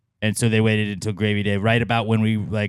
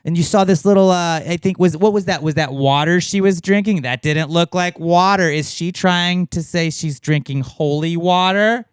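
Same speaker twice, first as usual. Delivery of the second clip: mock fan theorist; 5 semitones higher than usual. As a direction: tease the fans by over-reading every clue. Parodying a Swiftie analysis.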